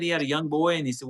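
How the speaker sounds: ordinary talk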